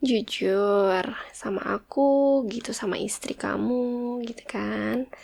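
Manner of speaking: drawn-out unvoiced whisper; slow whisper. Wife urging her husband to be honest, in a slow whisper. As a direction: breathe it slowly and softly, coaxing.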